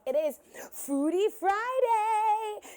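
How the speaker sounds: slow, high, loud showbiz announcement; drawn-out announcer hype. Real, not acted. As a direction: stretch it out like a TV host. Host announces show segment.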